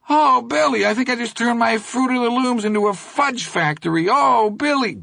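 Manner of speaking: mock a terrified crybaby; sniveling parody of Charlie Hogan. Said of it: "terrified, sniveling parody of Charlie Hogan".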